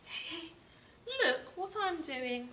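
lively storybook reading with big pauses; reading to children. Acted. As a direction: read it out to little ones, bright and expressive.

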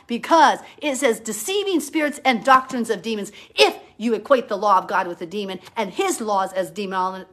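ordinary talk, nothing marked

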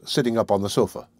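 ordinary delivery, nothing marked

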